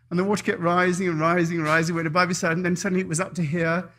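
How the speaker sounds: usual speaking voice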